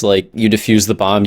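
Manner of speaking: ordinary delivery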